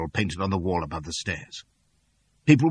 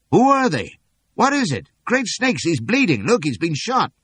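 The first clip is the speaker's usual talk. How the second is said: high, loud alarmed townsfolk; calling out in concern; 10 semitones higher, 6 dB louder than usual. A narrator's reading. Bystanders spotting a bleeding stranger, high alarmed calls. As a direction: call out, startled and worried. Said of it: "voices calling out in concern".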